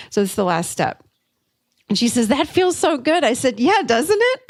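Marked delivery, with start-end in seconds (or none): 2.3-3.2 high-pitched, delighted reaction; retold delight. Retold client delighted by new belief. 3.6-4.4 high, gleeful agreement; retold delighted agreement. Coach shares the client's delight.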